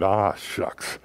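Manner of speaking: soft, lightly voiced grandpa; imitating grandpa's quiet dismay; Grandpa Herm. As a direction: mutter it like a let-down grandpa. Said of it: "good old Grandpa Herm".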